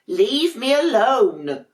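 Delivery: high, slow, cross little penguin voice; grumpy penguin. Acted. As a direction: push the siblings away, sulky and high. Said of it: "Dudley would say".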